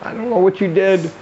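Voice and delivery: high, bewildered mock horror; acted-out aghast confusion; horrified woman. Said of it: "And she's horrified".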